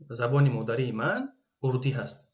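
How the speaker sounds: usual speaking voice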